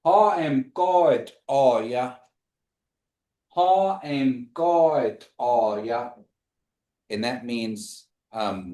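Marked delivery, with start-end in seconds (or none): 0.0-2.2 "Ha em God are ya?" heel langzaam, elke lettergreep apart; slow demo. Shown as a demonstration. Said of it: "And the question is". 3.5-6.1 "Ha em God are ya?" nog trager herhaald; slower repeat. Shown as a demonstration. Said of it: "And the question is".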